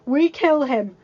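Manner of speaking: loud, driving warning; rabble-rousing hunter. Acted. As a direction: stir the crowd against the beast. Gaston incites the mob.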